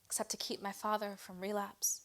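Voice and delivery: measured spoken-word delivery with weighted pauses; poetry reading, pausing between images; poem recitation. Acted.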